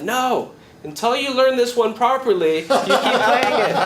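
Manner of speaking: high-pitched, insistent telling-off; mock scolding. Spanish audience tells player to learn it properly, scolding. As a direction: imitate a crowd laying down the law.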